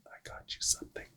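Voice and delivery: hushed whisper, no voice at all. Retold or imitated. Paramedic whispering to grumpy old patient about a gift, hushed whisper. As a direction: breathe it like a secret surprise.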